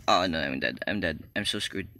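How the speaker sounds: low, soft resigned muttering; quiet dismay. Real, not acted. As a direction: mutter to yourself that it's over. Resigned after being trapped.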